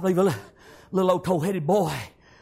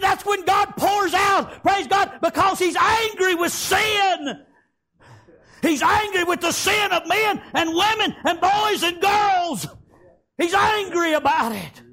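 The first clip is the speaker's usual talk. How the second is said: shouted preaching; 10 semitones higher, slower than usual. Acted. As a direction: roar each phrase like a revival preacher. Grandfather explains wrath of God.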